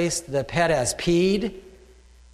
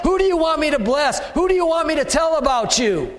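eager prayer; 9 semitones higher than usual. Retold or imitated. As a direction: ask it like an eager believer starting the day. Model prayer continues.

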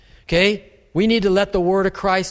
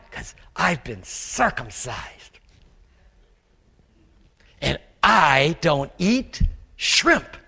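mock smug; 3 semitones lower, slower than usual. Mocking self-righteous believer.

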